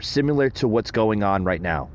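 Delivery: ordinary talk, nothing marked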